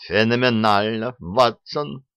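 ordinary delivery, nothing marked

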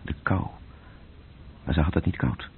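normal talking voice

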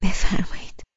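a shaky, breathy invitation; nervous bride barely voicing it; trembling voice. A narrator's reading. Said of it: "با صدای لرزان گفتم". (با صدای لرزان)

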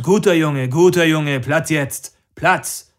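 loud praise turning into a firm order; commanding a dog. A narrator's reading. Max calms and orders his dog down, loud commanding.

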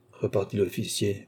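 plain reading voice

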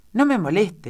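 loud, high, annoyed rebuff; irritated exclamation. A narrator's reading. Duchess silencing Alicia's sums, loud and irritated. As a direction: brush them off sharply, raising the voice.

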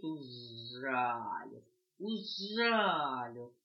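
slow drawn-out whimper; stung puppy wailing slowly as he runs; stung puppy whine. Acted. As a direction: wail that you got stung.

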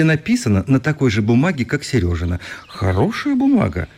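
ordinary talk, nothing marked

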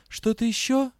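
a hoarse, caught question; suddenly hoarse. A narrator's reading. Heroine asks the lord-director, suddenly hoarse. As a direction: her voice cracks as she asks. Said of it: "внезапно осипшим голосом спросила я".

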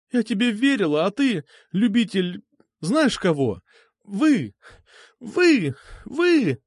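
put-on high girlish voice, rising to outcries; betrayed accusation. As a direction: build from hurt reproach to high exclamations. Betrayed girl accuses both.

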